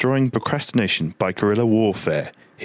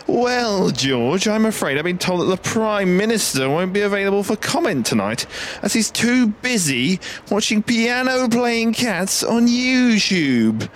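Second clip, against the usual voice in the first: higher, slow pompous reporter; posh field reporter voice; 11 semitones higher than usual. Acted. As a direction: play a stuffy outside reporter. Reporter at Downing Street.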